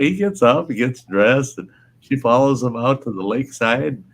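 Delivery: ordinary talk, nothing marked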